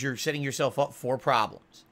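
usual speaking voice

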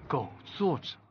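very soft, doubtful repeat; quiet questioning echo. Acted. Boss questioning the employee's insult, in a hushed echo. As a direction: echo the phrase under your breath.

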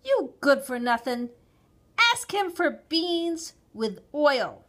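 scold, then spell out the instruction; loud, emphatic exasperated mother. A narrator's reading.

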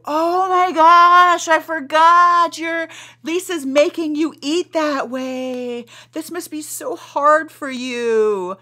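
high, slow, mock sympathetic voice; pitying coworker. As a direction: put on a syrupy coworker's pity. Coworker's pitying remark acted out.

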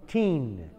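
put-on "Teen" with a firm final n; clear teen. Shown as a demonstration. Teacher showing the class "Teen". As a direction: land the n in "Teen". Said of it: "T I N".

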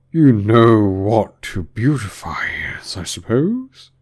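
loud, drawn-out quizzing; condescending Griffin. A narrator's reading. Griffin testing Alice, slow and condescending. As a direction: stretch it out, rising at the end.

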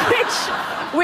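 unvoiced breathy laugh; real giggle. Real, not acted. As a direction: laugh before speaking.

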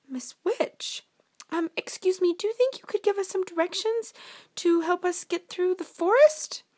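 high-pitched princess asking for help; polite princess. Acted. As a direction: ask sweetly in a high fairy-tale voice. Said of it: "they came upon an ugly witch".

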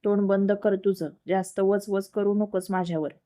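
ordinary delivery

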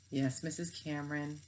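put-on a boy grudgingly mumbling to his teacher; mumbled. A narrator's reading. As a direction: mumble it down at the desk. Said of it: "mumbled Kyle".

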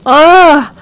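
loud, high-pitched groaning complaint; exasperated dismay. Real, not acted. Player facing a burnt stove to replace, exasperated. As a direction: cry out in dismayed disbelief.

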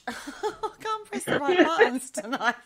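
loud, high and breaking into laughter; embarrassed laugh running through the words. Real, not acted. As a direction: talk through helpless giggles. Laughing over a phone mishap.

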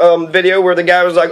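ordinary delivery

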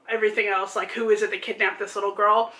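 ordinary talk

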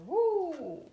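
high loud whoop; excited woo. Real, not acted. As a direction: cheer out loud. Cheers at card reading.

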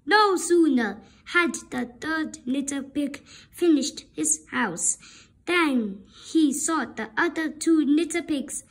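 plain reading voice

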